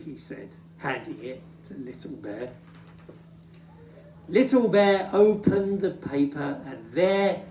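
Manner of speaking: ordinary delivery, nothing marked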